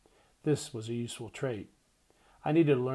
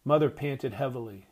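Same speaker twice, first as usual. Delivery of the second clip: usual speaking voice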